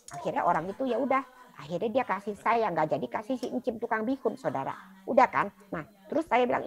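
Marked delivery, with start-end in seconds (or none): none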